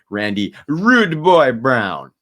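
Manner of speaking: put-on playful Jamaican accent, big finish.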